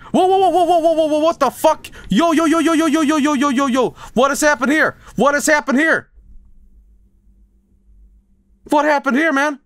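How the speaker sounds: high, rapid-fire repeated cries of alarm; panicked alarm. Real, not acted. Gamer reacts to sudden emergency in the sub, panicked. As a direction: blurt it out in a high-pitched panic.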